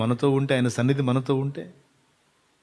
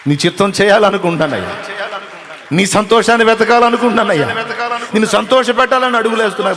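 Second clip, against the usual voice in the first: much higher, loud pleading cry; impassioned prayer; 9 semitones higher, 10 dB louder than usual. Acted. Preacher crying out a prayer to the Lord. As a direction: pour out the longing at full voice.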